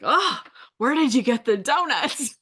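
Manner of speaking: light mock of an excited husband; eager question. Husband asks about donuts.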